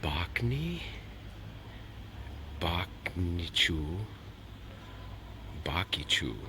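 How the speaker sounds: whispered, halting tries at Bakichu; sounding out a name. Real, not acted. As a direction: spell out Bakichu slowly under the breath. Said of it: "It says here".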